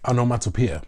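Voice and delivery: ordinary talk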